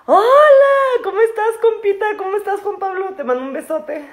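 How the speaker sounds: light norteña twang, high and loud; northern Mexican accent. Pretend. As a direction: greet like a lively norteña. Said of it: "luego empiezo a hablar como norteña".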